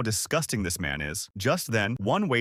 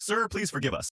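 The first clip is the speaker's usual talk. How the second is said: higher-pitched, mildly apologetic plea; 11 semitones higher than usual. A narrator's reading. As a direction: beg pardon, pitch lifted. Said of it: "says apologetically".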